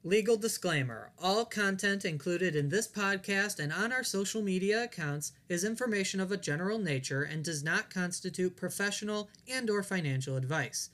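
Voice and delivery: rapid disclaimer read; fast legal disclaimer. Acted. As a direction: rattle off the fine print.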